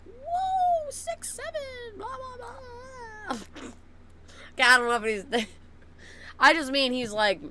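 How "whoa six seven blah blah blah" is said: very high, soft, drawn-out mock whoop; silly high-pitched mimicry. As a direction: imitate him goofing around in a thin high voice. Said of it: "he's probably just out there like".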